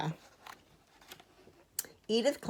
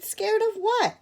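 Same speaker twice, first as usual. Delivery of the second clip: very high, incredulous question; high-pitched disbelieving little bear; scornful snort; 3 dB louder than usual. A narrator's reading. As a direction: scoff at the very idea. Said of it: "Little Bear snorted".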